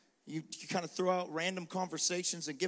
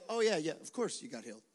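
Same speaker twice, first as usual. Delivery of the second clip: nonchalant; 5 semitones lower, 4 dB quieter, faster than usual. Pretend.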